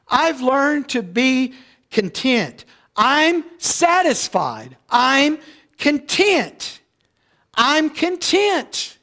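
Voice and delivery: slow emphatic quote. Acted. Preacher voices Paul's contentment.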